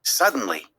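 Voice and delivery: plain reading voice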